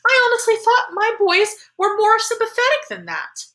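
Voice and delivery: high-pitched, indignant motherly scolding; angry. A narrator's reading. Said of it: "said Mom angrily".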